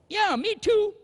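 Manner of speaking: a newlywed husband squirming on the phone. Retold or imitated. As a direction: squeak it out to dodge the words. Husband dodging saying I love you.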